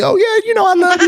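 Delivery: high laughing imitation of a careless girlfriend; mocking a girlfriend. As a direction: parody a woman tossing off "I love you". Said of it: "They'll tell you they love you all day".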